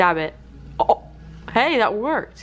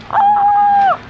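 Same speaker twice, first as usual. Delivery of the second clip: high, loud shriek of joy; thrilled high scream; excited shriek; 7 dB louder than usual. Real, not acted. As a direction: shriek with delight on arrival. Arriving excited at restaurant.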